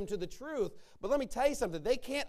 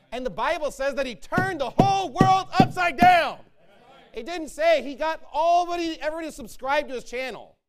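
shouted, much higher and louder preaching climax; full-force preaching; 8 semitones higher, 13 dB louder than usual. Acted. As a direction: bellow it out to the congregation like a revival peak.

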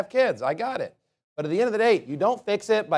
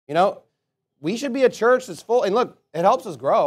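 normal talking voice

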